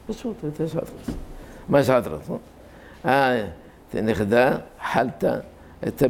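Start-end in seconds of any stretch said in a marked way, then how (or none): 0.1-1.1 higher, much softer frail echo; old rabbi's reply. Retold or imitated. Preacher imitating the elderly rabbi's modest answer, frail. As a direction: murmur it like a frail old sage.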